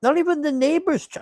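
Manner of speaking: very high, quick squeak of disbelief; incredulous. Real, not acted. Reviewer incredulous at the plot.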